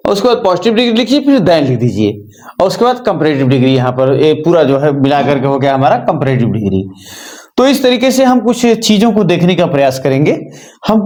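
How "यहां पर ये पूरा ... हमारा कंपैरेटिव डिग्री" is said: normal talking voice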